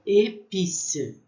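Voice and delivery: épice with a closed é, slow; French épice. Shown as a demonstration. Teacher modeling épice for students, slow French. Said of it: "o som com esse acento agudo".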